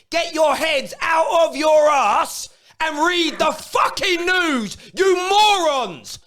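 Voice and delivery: real furious shout; angry rant. Real, not acted. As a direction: yell it at the fans, fed up. Ranting at Chelsea fans.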